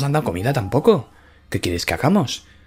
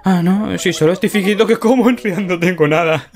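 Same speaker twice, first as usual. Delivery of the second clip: high, sheepish character voice; Chuskis's voice; 8 semitones higher than usual. Acted. Chuskis admits to friend he's pretending to eat, high sheepish voice. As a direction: confess sheepishly in a squeaky voice.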